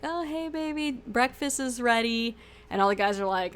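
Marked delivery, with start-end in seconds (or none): 0.0-2.4 mock sweet-talking, pitched up; flirty wife. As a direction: imitate a wife sweetly calling her man, drawn out. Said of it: "coming up there like".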